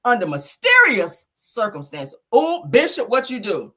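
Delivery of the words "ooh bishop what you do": louder, higher teasing ooh bishop. Real, not acted. Host teasing the bishop about the death. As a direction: needle the bishop playfully.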